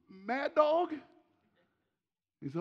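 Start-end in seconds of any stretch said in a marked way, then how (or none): 0.1-0.9 cheeky question. Retold or imitated. Student asks the nickname again.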